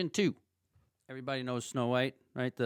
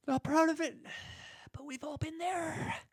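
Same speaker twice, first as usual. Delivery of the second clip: sheepish; 4 dB quieter than usual. Real, not acted. Sheepish confession of snacking.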